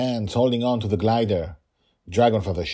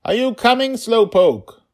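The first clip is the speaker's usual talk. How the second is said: put-on teasing child voice; 9 dB louder than usual. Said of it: "she said".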